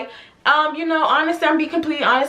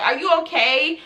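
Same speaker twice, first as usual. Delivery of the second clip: ordinary talk, nothing marked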